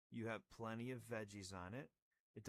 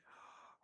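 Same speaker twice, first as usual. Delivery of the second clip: gasp; 11 dB quieter than usual. Real, not acted. Discovers no chocolate.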